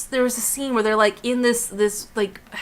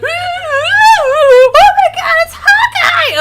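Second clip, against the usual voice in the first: high, loud, drawn-out fan squeal; mock excited shriek; 10 dB louder, much slower than usual. Everyone reacting to Hawkeye turning up in Thor, mock excited shriek. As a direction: shriek it like an overexcited crowd.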